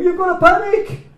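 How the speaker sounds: high, loud panicked shout; mock panic. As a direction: yell it like a frightened crowd. Mocking panicked people.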